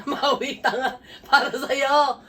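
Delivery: laughing as you blurt it out. Real, not acted. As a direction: talk through a laugh. Laughing at the gift-giver's slip.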